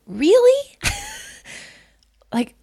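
high, loud disbelieving really with a laugh; can't believe it, almost laughing; shocked, laughing disbelief; stunned disbelief. Retold or imitated. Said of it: "and I was like".

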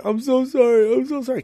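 high, pleading sorry; boy begging his dad, crying; mock crying apology. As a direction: plead like a sobbing kid. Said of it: "I'm crying".

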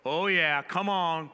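loud churchy call-back; congregation call-back. Retold or imitated. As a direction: shout encouragement back at the preacher. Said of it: "they go".

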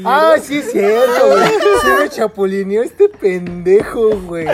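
slow, loud, laughing through words. Real, not acted. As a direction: laugh as you remember it. Remembers being betrayed, laughing.

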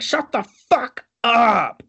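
high, loud, slow yell; mock yell. Imitating Toby yelling at the singing Don Quixote. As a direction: yell at him to shut up. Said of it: "Toby's all yelling at him".

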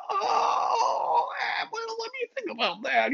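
very high, nerdy hesitant voice; Bill Gates voice. Retold or imitated. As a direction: dither like a hyper-nerd. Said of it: "the nerdiest voice in the universe".

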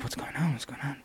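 unvoiced puzzled whisper; whispered what's going on. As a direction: hush it like onlookers murmuring. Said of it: "they're like, looking like".

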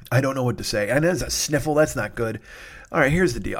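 ordinary talk, nothing marked

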